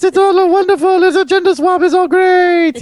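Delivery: put-on high slurred French Canadian drunk voice; French Canadian drunk accent. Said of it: "I can hear him now in his French Canadian drunk accent".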